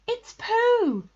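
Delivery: high, loud cry of discovery; excited. A narrator's reading. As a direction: shout out in delighted recognition. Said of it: "said Christopher Robin excitedly".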